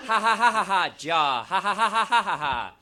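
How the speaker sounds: usual speaking voice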